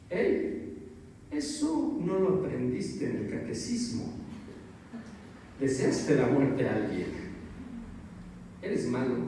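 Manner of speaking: a quiet conscience reproaching you; stern inner conscience. Retold or imitated. Conscience scolding you for wishing your boss dead, hushed and stern. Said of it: "tu yo moral, que te dice".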